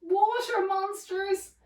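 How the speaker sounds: high, slow mock terror; alarmed cry. A narrator's reading. Sister sees her reflection in the water, alarmed cry. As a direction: wail it out in storybook alarm. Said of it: "and one cried".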